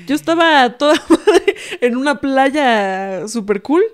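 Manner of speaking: loud, slow, laughing imitation; laughing retold quote. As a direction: break into laughter while quoting him. Laughs retelling dad's absurd dream excuse. (cita imitada entre risas, en voz alta)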